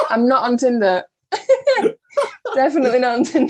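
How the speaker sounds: high, loud denial amid real laughter; amused protest. Real, not acted. Guest denying she is on a dating app, amused protest. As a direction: protest loudly between bursts of laughter. Guest jokingly denies being on Tinder.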